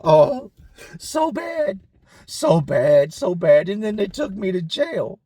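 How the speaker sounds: lightly voiced troubled cellmate. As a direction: whine it like the cellmate. Cellmate answers, distressed.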